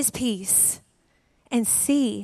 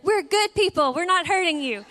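coaxing; 6 semitones higher, 3 dB louder than usual. Retold or imitated. Coaxing trapped hummingbird.

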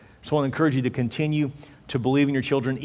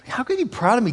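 ordinary talk

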